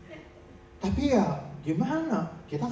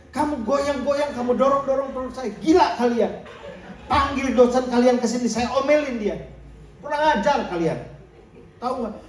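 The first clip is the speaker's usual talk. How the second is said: rising angry scolding, louder and higher; 3 semitones higher, 4 dB louder than usual. Retold or imitated. Pastor scolding the students who prayed over him, angry. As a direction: tell off the rude students, building to a loud rebuke. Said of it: "saya kan ngedumel tuh".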